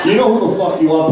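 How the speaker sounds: ordinary talk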